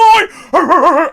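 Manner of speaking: loud, high, stuttering whoop; re-enacted crowd holler, rapidly pulsing; wild cheering noise. Acting out cheering for his son.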